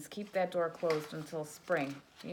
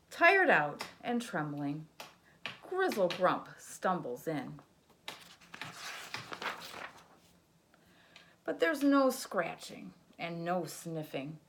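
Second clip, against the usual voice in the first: put-on lively read-aloud for a child; sing-song picture book narration; storybook reading; 9 dB louder, slower than usual. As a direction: read it like bedtime story time.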